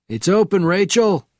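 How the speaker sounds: high, loud call through a door; shouting. A narrator's reading. Sergeant calling to Rachel from within, loud shout. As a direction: shout it from inside the room. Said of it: "shouted the voice from within".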